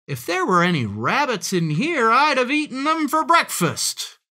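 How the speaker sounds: high, emphatic storybook fox; boastful fox. A narrator's reading. Fox thinks about eating rabbits, boastful. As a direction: swell with predatory bravado.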